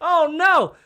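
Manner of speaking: loud, high lament; dismayed. Real, not acted. Storyteller lamenting his ruined guessing game, loud and high. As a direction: wail over your own blunder.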